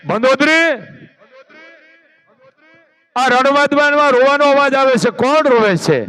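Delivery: Ravana calling. Acted. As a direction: Ravana calls out to Mandodari, commanding. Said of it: "કીધું મંદોદરીને". (રાવણનો ઊંચો હુકમી અવાજ)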